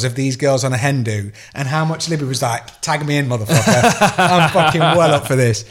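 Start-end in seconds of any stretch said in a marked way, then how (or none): 2.8-5.6 laughing, louder, up for anything; laughing daughter impression. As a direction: play the cheeky daughter through laughter. Daughter voiced up for fun.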